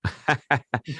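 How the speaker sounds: short amused chuckle; a quick chuckle before answering; laugh. Real, not acted. Guest amused by the eighth-book question, laughing.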